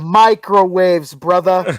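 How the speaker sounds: slow, booming exclamation; loud punchline reveal. Real, not acted. Storyteller revealing the kitchen's secret, loud and drawn out. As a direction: belt it out word by word.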